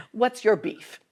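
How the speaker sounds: playful tough Italian-American challenge; meaner. Shown as a demonstration. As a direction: copy the tough guy, half laughing. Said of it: "My Italian's coming out".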